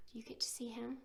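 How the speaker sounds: soft unvoiced whisper. Reader whispering to the children about the picture, hushed. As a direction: lean in and whisper to the kids. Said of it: "reader whispers to children".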